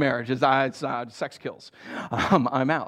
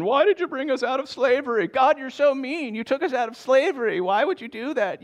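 mock whine, higher throughout; whiny grumbling; 9 semitones higher than usual. Preacher mimics Israelites grumbling about leaving slavery, mock whine. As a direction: sulk and accuse God. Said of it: "continuing with their grumbling".

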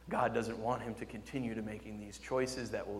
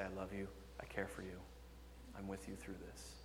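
much softer, gentle reassurance; tender Savior; 5 semitones lower, 17 dB quieter than usual. Acted. Preacher voicing the Savior embracing a hurting believer. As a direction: hold them close and reassure. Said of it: "the loving arms of the Savior".

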